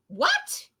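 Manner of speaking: high squeak of disbelief; shocked squeal; surprised. Pretend. Shocked reaction.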